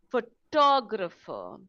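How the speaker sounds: PHOTOGRAPHER slowly, with a strong TOG, pho-TOG-rapher; second-syllable stress. Shown as a demonstration. Teacher shows word stress to learners, second syllable stressed. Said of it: "the stress is on the second syllable".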